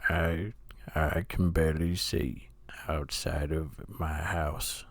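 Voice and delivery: low, soft, fading to a slow whisper; Morgan Freeman impression. Friend does a mock narrator, sinking to a low whisper. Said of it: "Morgan Freeman impression".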